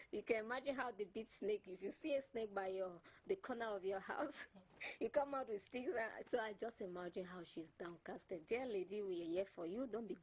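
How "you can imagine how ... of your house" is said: ordinary talk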